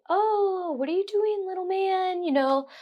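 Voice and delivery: put-on high, sing-song baby talk; cooing nurse. Nurse cooing to baby Elliot while fixing him, high sing-song baby talk. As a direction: coo to a baby like a cheerful nurse. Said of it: "come over and say".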